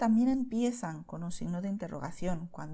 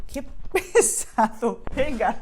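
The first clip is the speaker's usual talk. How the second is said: laughing exasperation; 2 semitones higher, 7 dB louder, slower than usual. Real, not acted. Rabbit interrupts the lesson.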